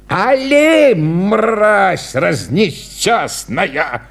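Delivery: hammed-up exasperated curse; clown cursing. A narrator's reading. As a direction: spit out the insult at the dog. Clown curses stubborn dog.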